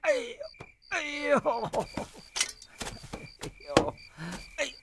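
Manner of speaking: groaning whimpers, hammed up; a touch of pained moaning.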